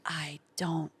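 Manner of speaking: hushed laughing whisper, slowed at the end. Retold or imitated. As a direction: whisper it like a gentle blow. Manager's blunt verdict, laughing.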